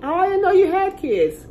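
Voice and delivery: high, surprised put-on voice; mock surprised acquaintance. Vlogger mimicking people who didn't know about her kids. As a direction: imitate someone caught off guard. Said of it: "some people say".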